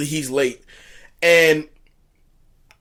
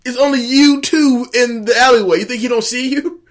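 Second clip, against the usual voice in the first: loud, higher, exasperated; 8 semitones higher, 5 dB louder than usual. Real, not acted. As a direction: call out the obvious. Mocking the character's obviousness.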